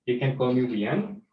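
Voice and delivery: ordinary talk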